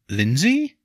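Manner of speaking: tentative, high questioning lift; uncertain. A narrator's reading. Nobleman venturing a woman's name at her door, uncertain. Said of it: "he ventured uncertainly".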